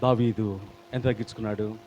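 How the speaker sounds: normal talking voice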